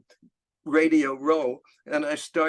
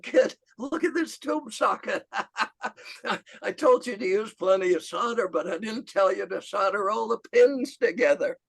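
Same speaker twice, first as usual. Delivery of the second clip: high-pitched, laughing retelling of a friend; amused mock disbelief, laughing through it; imitating helpless laughter; 7 semitones higher than usual. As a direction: act out explaining between bursts of laughter. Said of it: "he couldn't stop laughing".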